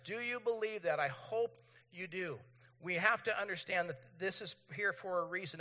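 usual speaking voice

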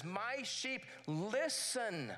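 very slow, measured reading; slow scripture reading. Shown as a demonstration. Pastor reading John ten twenty-seven to the church, slowly. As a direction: lay each word down slowly. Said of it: "it says this".

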